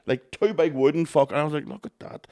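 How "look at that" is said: soft whispered 'Look at that'; awed whisper. Retold or imitated. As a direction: breathe it out in awe. Retold awe at food platters.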